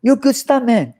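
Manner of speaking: high, excited mock Jackie Chan praise; Jackie Chan imitation. Imitating Jackie Chan praising him after the stunt, high and excited. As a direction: squeal it up like an impressed Jackie. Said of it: "he go".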